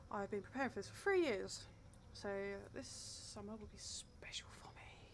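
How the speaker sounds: whispered diary reading. Real, not acted. Nervous player reads the diary entry aloud, whispering. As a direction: breathe the words, creeped out.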